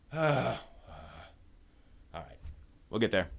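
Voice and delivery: ordinary talk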